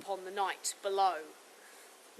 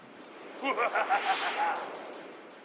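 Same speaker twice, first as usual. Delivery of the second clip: raucous laugh; 2 semitones lower than usual. Acted.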